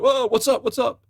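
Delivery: high-pitched startled questioning; Richard, alarmed. Retold or imitated. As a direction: imitate a friend reacting to a freak-out. Richard reacts to Larry freaking out.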